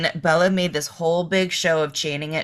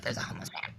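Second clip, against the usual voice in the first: whispering; 6 semitones lower, 15 dB quieter, much faster than usual. Retold or imitated. Imitating alarmed San Francisco people.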